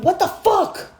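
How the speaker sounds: high-pitched exasperated outburst; retold yell. Woman yelling at her spirit guides, exasperated. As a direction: re-enact yelling up at the heavens. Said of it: "I yell at my guides".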